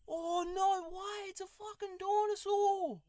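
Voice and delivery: high, slow, thrilled disbelief; amazed excitement. Acted. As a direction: gasp it out like you just saw a dinosaur. Spots a dinosaur in game.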